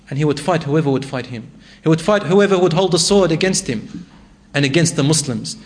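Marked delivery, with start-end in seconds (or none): none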